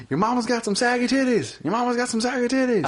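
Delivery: high, repeated mock play call; Peyton Manning. Quarterback roasting the defense, imitated calling-out voice. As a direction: holler the taunt like a quarterback. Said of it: "He was probably saying some shit like".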